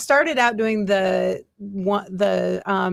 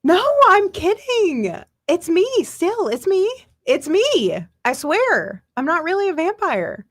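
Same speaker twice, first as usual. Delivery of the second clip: put-on high, slow pleading to a scared child; high pleading reassurance; pleading mommy; 9 semitones higher, 4 dB louder than usual. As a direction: plead high and slow that it was only a joke. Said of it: "I was like".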